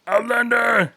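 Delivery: loud high monster bellow; Cookie Monster voice. Retold or imitated. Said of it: "I just gotta repeat that".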